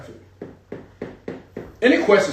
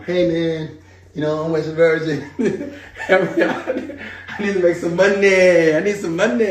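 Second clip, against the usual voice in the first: laughing, higher mock beggar; mock begging emailer; 4 semitones higher than usual. Mentor mocking a needy emailer, laughing mock plea. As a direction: play the desperate emailer for laughs. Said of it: "He said, "Wesley".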